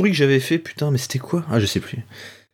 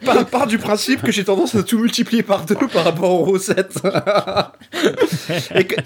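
laughing; 2 semitones higher than usual. Real, not acted. Laughing at own generous pour.

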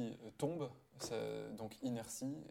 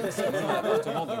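laughing; 14 dB louder, faster than usual. Real, not acted. Mocking Saito's shabby flat.

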